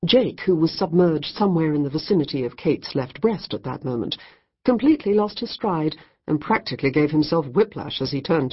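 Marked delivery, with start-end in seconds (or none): none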